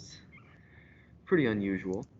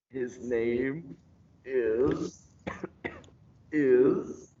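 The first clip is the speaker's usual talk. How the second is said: choking; 3 semitones higher, much slower than usual. Acted.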